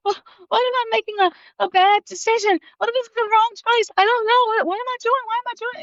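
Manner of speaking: high, strained mock sobbing worry; retold panicky crying. Daughter panicking to her mother before moving, high and tearful. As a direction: sound high and on the verge of tears. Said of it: "I was just like".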